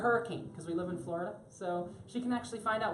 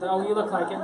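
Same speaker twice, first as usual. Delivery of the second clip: ordinary delivery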